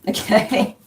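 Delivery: ordinary delivery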